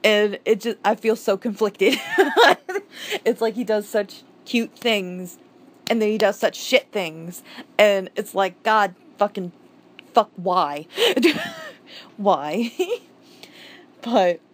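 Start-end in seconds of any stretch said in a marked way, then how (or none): 2.0-3.2 high laugh. Real, not acted. Conflicted about the character. 10.9-11.7 high laugh. Real, not acted. Exasperated with the character.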